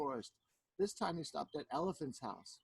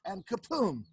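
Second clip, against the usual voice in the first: loud, higher burst; sudden kapoom; 4 semitones higher, 6 dB louder than usual. A narrator's reading. Storyteller drops Anansi with magic, sudden kapoom. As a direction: hit kapoom like a falling blow.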